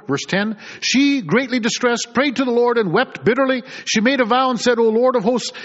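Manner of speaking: fervent pulpit reading of Scripture. Acted. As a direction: proclaim the verses to the congregation. Preacher reads scripture aloud.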